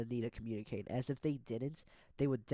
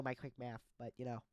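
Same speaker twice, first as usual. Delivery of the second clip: normal talking voice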